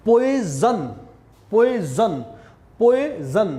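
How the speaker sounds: 'पॉइजन' broken up as 'poi-zan'; emphatic, separate repeats of 'पॉइजन' with z; clear z sound. Shown as a demonstration. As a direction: model 'पॉइजन' in two parts with a buzzing z. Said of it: "ज का sound दिया".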